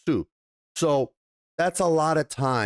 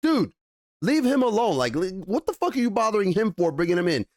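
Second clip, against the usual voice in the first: annoyed defense; 7 semitones higher than usual. Acted. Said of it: "And Cassian's like".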